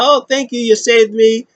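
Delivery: high-pitched imitation of grateful gushing; hammed-up adoring thanks; mock gushing thanks. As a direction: gush it high, playing the rescued admirer. Voices Liv gushing at her rescuer.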